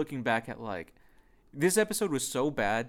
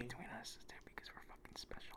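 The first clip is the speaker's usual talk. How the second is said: giggly prank whisper; 21 dB quieter than usual. Pretend.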